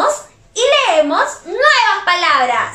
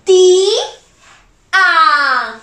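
"Ti, a" in two separate beats; sound by sound; 4 dB louder than usual. Shown as a demonstration. Teacher adding the lone vowel for children, sound by sound. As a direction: sound out "Ti", pause, then "a". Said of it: "si le agrego la vocal A".

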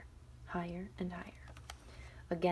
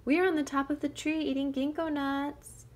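loud, higher call; 8 semitones higher, 10 dB louder than usual. Acted. Shang calling down to the wolf. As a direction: shout cheerfully from above.

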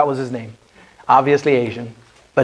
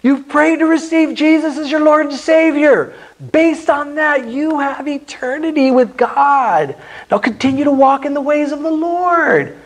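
much higher, bright encouraging celebration; joyful congratulation; 8 semitones higher than usual. Shown as a demonstration. Evangelist congratulates someone who just prayed, high and joyful. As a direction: cheer on a new believer warmly. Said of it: "we can say".

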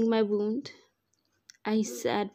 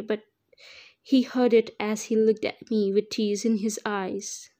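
ordinary delivery, nothing marked

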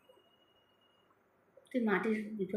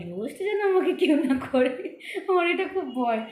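laughing nervously; 6 semitones higher, 7 dB louder than usual. Real, not acted. Fearing being roasted, laughing.

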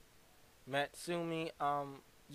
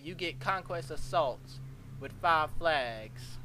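slow mock announcement; much slower than usual.

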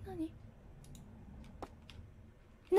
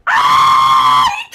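shout; 9 dB louder than usual. Real, not acted. Horror game scream.

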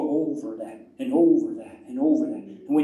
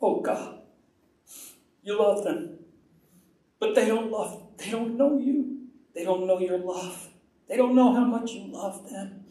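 higher-pitched pleading with God, retold; re-enacted cry of the heart; tearful prayer; 4 semitones higher than usual. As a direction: plead for them as if starting to cry. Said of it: "And I start to cry".

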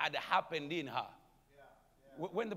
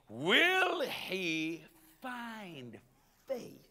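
slow drawn question; 2 semitones higher, much slower than usual. Acted. Jesus quoted in sermon.

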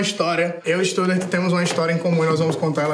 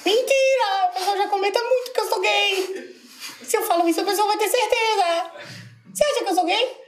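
put-on squeaky little-kid voice; childish voice; 13 semitones higher than usual. (voz infantil bem aguda)

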